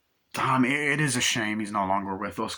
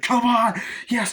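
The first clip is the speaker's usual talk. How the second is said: loud, high urging yell; over-the-top shout; 13 semitones higher, 7 dB louder, slower than usual. Retold or imitated. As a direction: yell it on, racing a train. Acting out racing the train.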